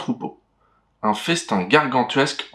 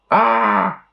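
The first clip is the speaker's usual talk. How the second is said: high, loud startled shriek; frightened scream; 9 semitones higher, 3 dB louder than usual. Acted. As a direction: shriek in fear.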